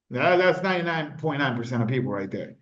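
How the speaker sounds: usual speaking voice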